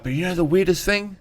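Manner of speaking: high, hushed telling of a secret; voicing the brother. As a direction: lean in and tease the reveal.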